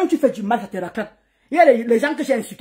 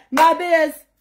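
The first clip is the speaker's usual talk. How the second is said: ordinary talk, nothing marked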